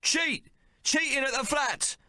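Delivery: high accusing yell. Acted. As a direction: bellow it to the whole pub. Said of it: "Maple yells to all".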